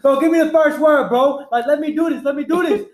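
high, insistent begging; eager readiness. Retold or imitated. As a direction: beg to go first, pumped up. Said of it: "So I was ready".

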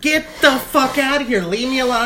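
acted fed-up outburst; exasperated yelling. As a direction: yell in disbelief at useless remedies. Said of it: "I'm yelling now".

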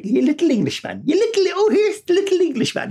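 put-on high taunting kid voice; sing-song jeer like a cousin; mocking cousins. Irish cousins taunting the newcomer boy, high and mocking. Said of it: "they infuriated me".

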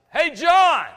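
a loud, high call across a ballfield; retold yell. Coach calling a boy across the practice field, loud yell. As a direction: holler the boy's name from far off. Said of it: "Coach Bryant yells".

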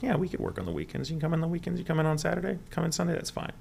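high, breezy rattled-off offer; casual studio offer. Retold or imitated. Studio offering the actor weekend work, high easygoing patter.

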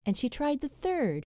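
usual speaking voice

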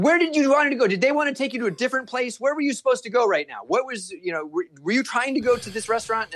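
high, fast demanding questions; wife grilling the confused Germans; mock yelling. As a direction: fire questions at the tourists. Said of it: "Kristen starts yelling at them".

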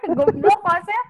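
slow, high laughing tease; mock the host while laughing; laughing insult. Real, not acted.

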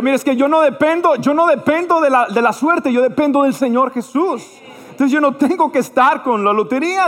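high-pitched, insistent declaration of faith; retold protest, driven hard and high; emphatic refusal. Believer turns down the seller's lottery tickets, emphatically.

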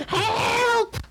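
very high, long cry; mother's cry for help. Retold or imitated. Skinner's mother calling for help, very high cry. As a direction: cry out like a panicked old lady. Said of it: "the mum in the background".

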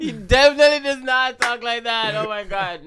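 loud, high-pitched objection; indignant protest. Real, not acted. Co-host defending boyfriend from an impression, indignant protest. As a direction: jump in loudly to defend him.

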